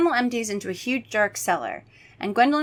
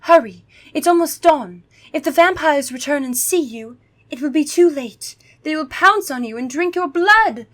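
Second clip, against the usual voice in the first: put-on higher, louder urgent girl voice; urgent vampire girl; 8 semitones higher, 5 dB louder than usual. Gwendolyn rushing the kids out before dawn, urgent. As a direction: hurry them along in a put-on girl voice.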